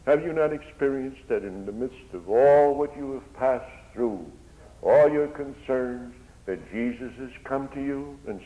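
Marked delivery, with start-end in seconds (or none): none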